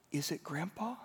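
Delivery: soft, high, rising question; lightly voiced small child. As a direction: imitate a worried little granddaughter asking gently. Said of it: "she looks up at Grandma's eyes and she says".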